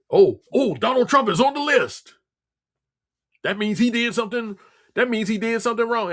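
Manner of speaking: lampoon them, pitch up and gush; mocking accuser. Mocking the accusers' eager claims.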